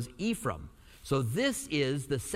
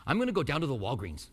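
quick Albuquerque accent; faster than usual. Shown as a demonstration. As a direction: demonstrate a fast-running Albuquerque accent. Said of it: "Here's the Albuquerque accent".

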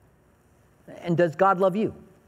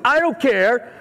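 put-on bratty kid; 6 semitones higher, 3 dB louder, slower than usual. Defiant son answers back.

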